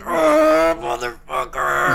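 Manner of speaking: loud strained snarl of a curse; furious curse. Acted. Levi snarling at Carl in fury, loud angry curse. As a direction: spit the curse out in rage.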